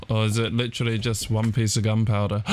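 ordinary delivery

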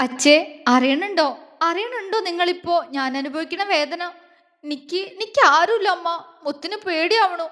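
lips quivering on the edge of tears; tearful pleading. Acted. Orphaned girl calling to her dead parents, tearful pleading. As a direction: plead like a lost, frightened child. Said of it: "ചുണ്ടുകൾ വിതുമ്പിക്കൊണ്ട് പറയുമ്പോഴും".

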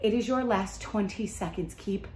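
ordinary talk

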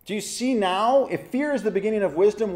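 ordinary talk, nothing marked

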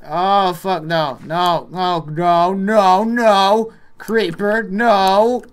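loud, high panicked shouting; real panic. Real, not acted. Gamer spots a creeper coming, panicked shouting. Creeper attacks during play.

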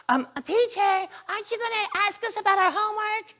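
very high, loud pupil voice; mock the kid who reminds the teacher; annoying school kid. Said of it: "that typical kid in school".

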